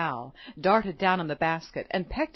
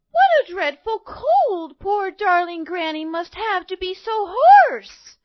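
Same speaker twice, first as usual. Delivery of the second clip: put-on high, slow, loud girlish fretting; worried little girl; 10 semitones higher, 7 dB louder, slower than usual. A narrator's reading. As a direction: fret over granny as a little girl. Girl worries about granny.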